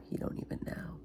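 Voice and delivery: hushed, voiceless aside; whisper. Real, not acted. Mother's aside about Lincoln's answer, whispered.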